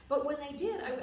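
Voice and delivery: normal talking voice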